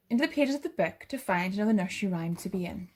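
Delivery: ordinary delivery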